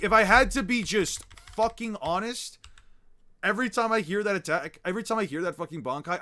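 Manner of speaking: loud, high, emphatic ranting; real worked-up fan outburst; heated rant. Real, not acted. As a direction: rant at the chat in disbelief, hitting the key words hard.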